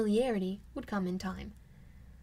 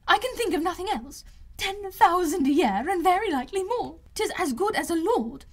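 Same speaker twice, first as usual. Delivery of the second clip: much higher and louder, breathless with delight; gushing excitement; 7 semitones higher, 5 dB louder than usual. A narrator's reading. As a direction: gush like an overjoyed mother who can't stop. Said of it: "she cried".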